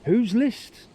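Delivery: high, puzzled mimic of Tom; Tom's baffled reply. Tom asks his grandson, mock-baffled and high. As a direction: squeak it out, clueless. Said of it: "he turned to me and he goes".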